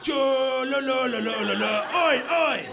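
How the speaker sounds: put-on loud, slurred, sing-song drunk babble; mock English tourist yelling gibberish abroad; drunk Englishman abroad. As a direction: bellow like a drunken lout on holiday. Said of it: "sounds like a deaf person having a stroke".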